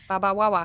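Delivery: "Baba Wawa" with W for R; playful mispronunciation. Retold or imitated. Playful mimic of Barbara Walters nickname.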